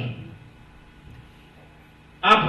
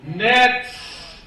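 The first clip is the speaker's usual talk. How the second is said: raised preaching read of the text; preacher reading Luke aloud with fervor; scripture reading; 3 semitones higher, 5 dB louder than usual. Acted. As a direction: proclaim the verse to the congregation. Reads scripture.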